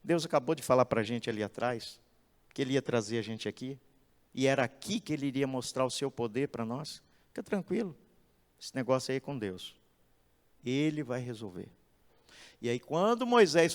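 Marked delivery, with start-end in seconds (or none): 0.0-11.7 low, soft and unhurried reassurance; calm Moses. Acted. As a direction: settle a panicking crowd gently. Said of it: "Moisés tranquilamente".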